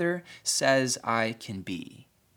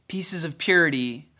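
normal talking voice

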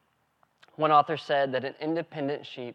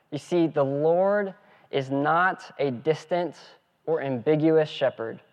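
normal talking voice

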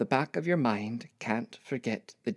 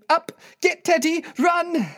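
loud, high, urgent shouted orders; frantic full-voiced alarm; roaring; 9 dB louder, slower than usual. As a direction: yell in panic to get everyone moving. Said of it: "roaring at Pete to get down the stairs".

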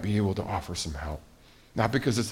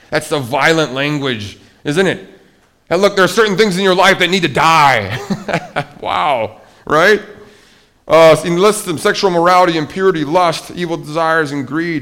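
higher and louder, urgent preaching; full-force preaching; 7 semitones higher, 8 dB louder than usual. Real, not acted. Preacher listing sins to congregation, full force. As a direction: preach it with fire.

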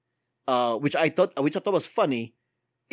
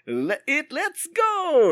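much higher, bouncy Mario "let's go"; Mario catchphrase; 12 semitones higher, much slower than usual. Retold or imitated. Host shows the catchphrase Chris Pratt skipped, in a much higher Mario voice. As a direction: belt out Mario's cheer. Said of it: "You didn't do the Mario".

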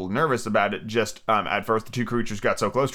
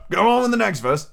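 raised but milder impatient order; 8 semitones higher, 3 dB louder than usual. A narrator's reading. Griffin repeating the order to Alice, impatiently. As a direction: urge it on with a touch of impatience. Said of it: "repeated impatiently".

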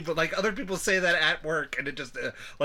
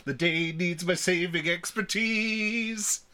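slow, heroic swaggering singer; Captain Hammer; 4 semitones higher, much slower than usual. Acted. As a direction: ham it up like a cocky superhero. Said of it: "More Doctor Horrible".

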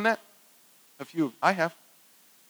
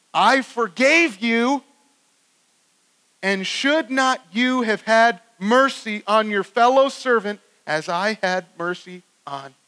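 slow, emphatic, raised rebuke; reproving master; 3 semitones higher, slower than usual. Acted. Master to the unforgiving servant, slow stern reproach. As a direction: weigh out each word, starting high and settling down. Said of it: "the master said to him".

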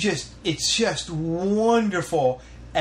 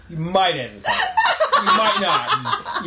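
laughing; 4 dB louder than usual. Real, not acted. Teasing while laughing.

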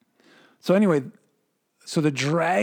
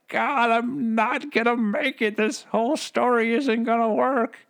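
higher, pleading mock wail to God; mock crying out; 9 semitones higher than usual. The dragon crying out to God, hammed-up plea. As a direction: beg like a doomed villain, half in jest. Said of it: "crying out to God".

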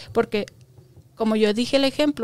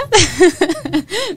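laugh; 8 semitones higher, 9 dB louder than usual. Real, not acted. Laughs at her own imitation.

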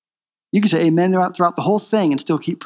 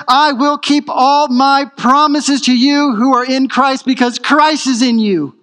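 building preaching; 6 semitones higher than usual. Acted. Preacher building God's answers to the church, rising in force. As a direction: preach each yes a step higher, building to a peak.

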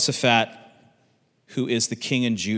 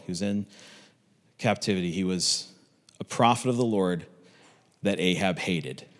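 usual speaking voice

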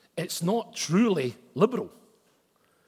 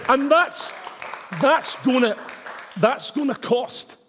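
higher, louder, fervent proclamation; preaching at full force; 8 semitones higher, 5 dB louder than usual. Real, not acted. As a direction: thunder it out to the congregation.

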